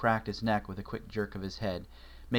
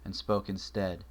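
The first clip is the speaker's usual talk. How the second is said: plain reading voice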